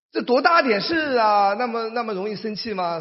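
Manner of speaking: high, scoffing disbelief; mock incredulous. Preacher scoffing at a small matter, mock disbelief. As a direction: play it down with a scoff. Mocking a petty grievance.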